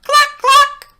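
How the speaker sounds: very high, loud clucking hen. A narrator's reading. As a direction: cluck like a hen about to lay. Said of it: "Then she said".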